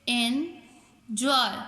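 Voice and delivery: एंजॉय as two separate beats, एन, then जॉय; teacher sounding out एन—जॉय; split into syllables. Shown as a demonstration. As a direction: say एंजॉय slowly with a pause before जॉय. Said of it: "टू vowel sound देते हों".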